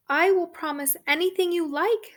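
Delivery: high, loud and fast; a desperate princess gushing; eager princess. Acted. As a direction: blurt out the promise eagerly.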